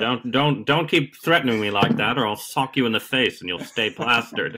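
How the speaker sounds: a playful half-hearted Buckley threat; mock Buckley. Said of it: "a Buckley impersonation is gonna be required".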